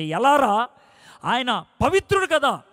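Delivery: incredulous question. Retold or imitated. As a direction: challenge him, unable to believe. Friend's doubting question in dialogue.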